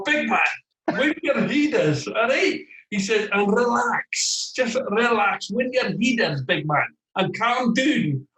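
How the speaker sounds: slow soothing Scottish manager voice; Scottish accent. Retold or imitated. Manager calming his young striker, Scottish accent. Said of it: "You got him in one".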